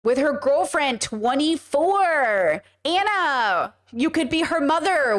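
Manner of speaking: high, drawn-out disbelief; exasperated scolding. Real, not acted. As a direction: stretch the key words in exasperation. Exasperated rant over age gap.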